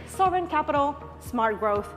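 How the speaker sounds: usual speaking voice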